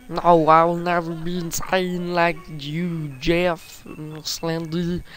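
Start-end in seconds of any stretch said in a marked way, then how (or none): none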